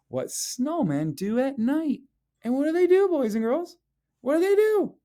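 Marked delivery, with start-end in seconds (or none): 2.4-4.9 excited question. Real, not acted. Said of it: "quizzing the class".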